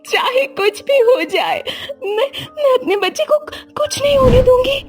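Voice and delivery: high, sobbing voice; tearful young wife. Acted. Diya crying to her mother-in-law about the dream. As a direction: sob out the nightmare to her.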